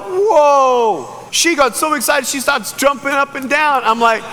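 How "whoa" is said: high, loud, drawn-out whoa; acted crowd amazement; amazed whoa. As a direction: exclaim in wonder, falling from high. Acts out onlookers' amazed reaction.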